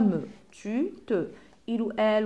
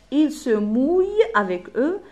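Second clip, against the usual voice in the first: slow and louder, "mouille" held out and raised; slow dictation; 4 semitones higher, 5 dB louder, slower than usual. Shown as a demonstration. Teacher gives pupils the next rhyme line, slow emphatic dictation. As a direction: dictate "Il se mouille" slowly, word by word.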